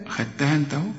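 normal talking voice

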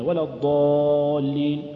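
ولا الضالين chanted slowly, alif rounded toward و; exaggerated dark alif in الضالين; over-heavy alif. Shown as a demonstration. Teacher mocking worshippers' over-heavy alif. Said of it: "المصلين أكثرهم يقولون كذا".

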